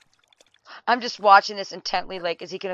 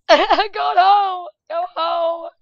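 relieved; 9 semitones higher, 9 dB louder, much slower than usual. Real, not acted. Laughing relief at game.

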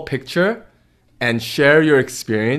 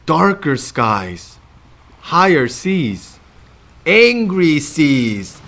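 building storm; much slower than usual. Acted.

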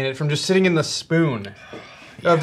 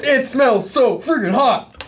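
excited; 9 semitones higher, 4 dB louder than usual. Real, not acted. Reacting to smelling hot sauce.

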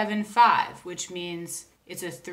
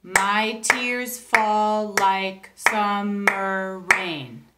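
each syllable spaced out very slowly; clapped syllables; 4 dB louder, much slower than usual. Shown as a demonstration. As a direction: clap out every syllable slowly. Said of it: "if you clap those syllables".

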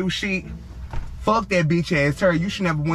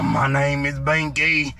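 slow, lower, a croaky ruined voice; lightly mocking damaged smoker voice; 8 semitones lower, slower than usual. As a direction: mimic a smoker's wrecked voice. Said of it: "Your voice gonna be crooked".

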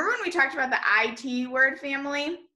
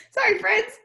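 laughing; 8 semitones higher than usual. Real, not acted. Laughing apology.

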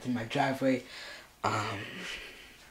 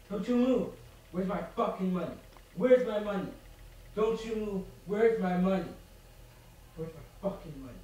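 menacing interrogation; 5 semitones higher, 6 dB quieter than usual. Acted. Demanding money from hostages.

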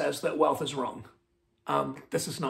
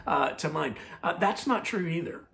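ordinary talk, nothing marked

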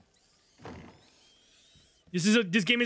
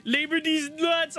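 high, giggly words through laughter; laughing; 10 semitones higher, slower than usual. Real, not acted. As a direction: barely get it out while cracking up. Cracking up at own joke.

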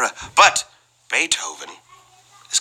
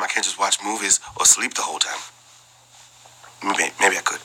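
whispering; 7 semitones lower, 6 dB quieter, faster than usual. Real, not acted. Aside about long flights.

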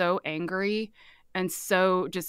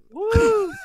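high, loud mock whoop on a sung note; playful high whoop; 14 semitones higher, 7 dB louder than usual. Showing off her toe piano high note, playful whoop.

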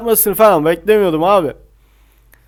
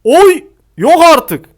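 stunned outcry; 8 semitones higher, 6 dB louder, slower than usual. Real, not acted. Reacts to shot off post.

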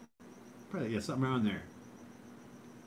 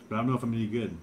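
ordinary delivery

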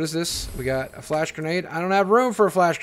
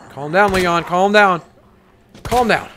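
urgent self-calming; 8 dB louder, slower than usual. Real, not acted. Panicking player steadies the character.

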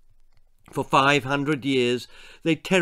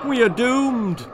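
high, loud anguished cry; weeping; 10 semitones higher, 6 dB louder than usual. A narrator's reading. Elders weep as the beasts appear, weeping. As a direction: despair breaking through. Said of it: "wept the elders".

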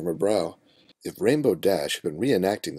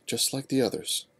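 ordinary delivery

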